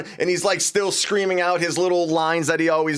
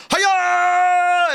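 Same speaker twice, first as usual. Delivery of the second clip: much higher, louder shouted hiya; battle cry; 12 semitones higher, 4 dB louder than usual. Retold or imitated. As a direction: belt out a karate-style yell. Captain America battle cry.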